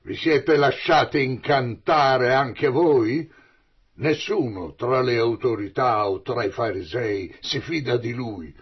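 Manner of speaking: rimprovero irato. Acted. Farisei rimproverano le guardie.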